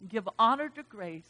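ordinary talk, nothing marked

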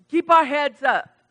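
ordinary talk, nothing marked

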